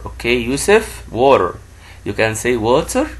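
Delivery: normal talking voice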